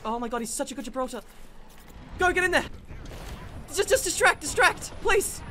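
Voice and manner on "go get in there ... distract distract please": high, raised voice begging; urgent pleading. Real, not acted. Player directing teammate in a fight, urgent pleading.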